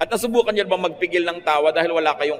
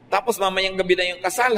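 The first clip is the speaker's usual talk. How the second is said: ordinary talk, nothing marked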